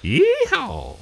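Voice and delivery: very high cowboy holler; country boy whoop. Pretend. Plays country boy, calls out.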